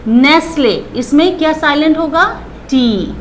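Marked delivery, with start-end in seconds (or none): none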